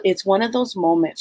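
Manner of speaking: ordinary talk, nothing marked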